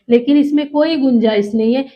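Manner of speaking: usual speaking voice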